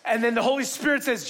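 ordinary delivery

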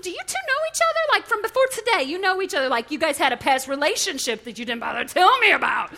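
mock accusing outburst. Wife confronting the contractor over the slip, in a mock accusing shout. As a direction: grill him like a jealous wife.

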